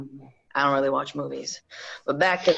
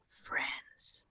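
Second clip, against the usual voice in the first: whispered, velvet threat; menacing whisper; 14 dB quieter than usual. Acted. Reggie whispering to Lincoln over the gun. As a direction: hush it like a secret threat.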